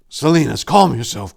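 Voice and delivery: usual speaking voice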